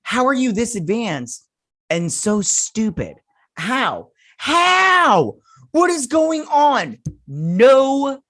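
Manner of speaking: high, loud, climbing to an exasperated shout on How; exasperated disbelief. Retold or imitated. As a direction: rail at them in disbelief. Her own exasperated retort.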